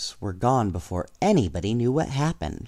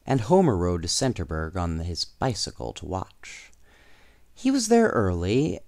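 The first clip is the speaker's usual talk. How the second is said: plain reading voice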